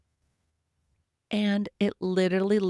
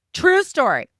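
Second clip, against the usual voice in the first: excited aside; 10 semitones higher, 5 dB louder than usual. Real, not acted. Excited about the coincidence.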